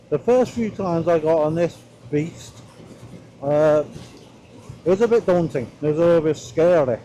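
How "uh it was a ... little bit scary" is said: ordinary delivery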